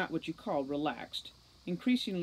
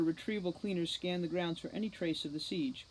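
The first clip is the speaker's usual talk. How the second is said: ordinary delivery, nothing marked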